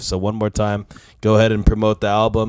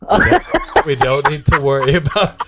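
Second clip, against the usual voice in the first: cracking up; 6 semitones higher, 8 dB louder than usual. Real, not acted. Laughs mid-impression, voice jumps.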